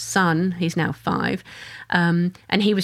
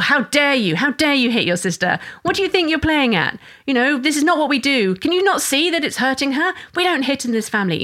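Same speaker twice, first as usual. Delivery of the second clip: charged scolding; 7 semitones higher than usual. Retold or imitated. Parent berating a child for hitting her sister, angry. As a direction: scold the child, voice climbing with outrage. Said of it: "there's such charge around".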